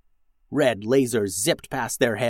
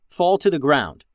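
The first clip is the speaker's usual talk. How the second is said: ordinary delivery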